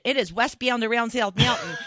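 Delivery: "South Mountain" with squashed, odd "ow" vowels; on-air report in a peculiar accent; strange accent. Retold or imitated. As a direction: say it quickly in her odd accent. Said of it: "I have this really strange accent".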